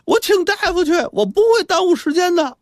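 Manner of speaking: put-on very high, urgent child's shout; calling back. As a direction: yell back as a boy in a hurry. Boy shouts back while running off.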